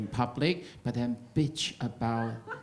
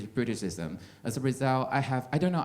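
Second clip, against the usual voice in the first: ordinary delivery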